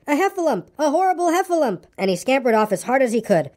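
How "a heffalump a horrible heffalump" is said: high, alarmed little Piglet; panicked cry. A narrator's reading. Piglet flees the supposed monster, high panicked cry. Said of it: "cried Piglet".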